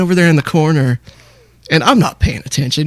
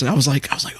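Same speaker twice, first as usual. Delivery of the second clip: normal talking voice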